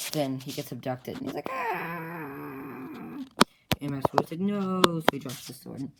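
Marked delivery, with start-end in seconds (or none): 1.5-3.2 high-pitched cartoon scream of someone snatched away; high mock scream; high abducted scream. 4.4-4.8 high, loud cry of dismay; mock anguished no; shouted no. Master Koi cries out.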